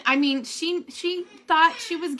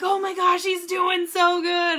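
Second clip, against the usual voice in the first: excited shout; 5 semitones higher than usual. Retold or imitated. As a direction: call it out overjoyed. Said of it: "He's like".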